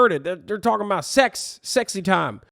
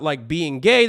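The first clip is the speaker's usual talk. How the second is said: ordinary talk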